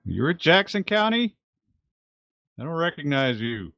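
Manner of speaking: high, projected challenge; calling out. Acted. Howard questioning the sheriff from below, high call. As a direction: question a stranger from a distance.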